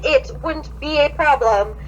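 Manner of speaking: usual speaking voice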